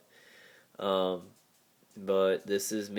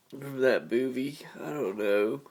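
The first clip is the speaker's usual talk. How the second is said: ordinary delivery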